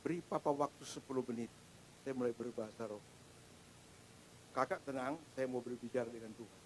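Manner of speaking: put-on soft, low, calming words to his child; father's quiet reassuring voice; calm reassurance. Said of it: "Saya bilang".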